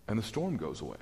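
ordinary talk, nothing marked

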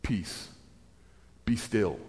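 quiet, gentle command; calm command. Acted. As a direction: still the storm without raising the voice. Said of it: "he stands up and he says".

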